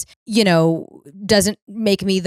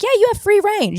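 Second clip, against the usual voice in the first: breezy boss; 14 semitones higher, 5 dB louder than usual. Retold or imitated. Boss voice to employees.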